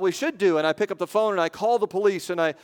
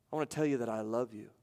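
low and much softer; quiet, calm; 5 semitones lower, 10 dB quieter, faster than usual. Retold or imitated. Victim's father speaking to the murderer, quiet and calm. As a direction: gently voice a father's understated love. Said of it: "very, very quietly, very calmly".

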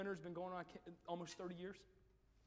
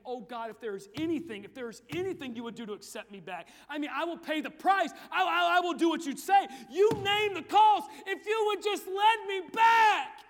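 rising from plea to desperate shout; begging wail; 8 semitones higher, 18 dB louder than usual. Acted. Penitent on the altar begging God for acceptance. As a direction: cry out and beg louder and louder. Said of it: "I'm talking wailing and crying and begging".

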